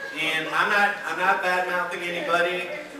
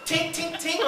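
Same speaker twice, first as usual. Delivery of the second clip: tinking sound; 11 semitones higher, slower than usual. Retold or imitated. Imitating the mint landing.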